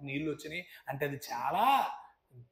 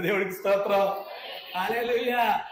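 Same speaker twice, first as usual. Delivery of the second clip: higher, louder hallelujah; praise shout; 5 semitones higher, 7 dB louder than usual. Real, not acted. Preacher celebrating his father's baptism, loud joyful shout. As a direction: burst out in praise.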